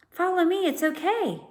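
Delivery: high, carrying call down a tree; calling up to Tom. A narrator's reading. Stella calls down to Tom, high bright call. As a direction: call back reassuringly to her friend. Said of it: "she called".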